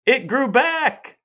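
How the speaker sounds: high, loud, slow exclamation; excited reveal. Pretend. Host revealing to co-host that the toe grew back, thrilled shout. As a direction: announce the miracle with glee.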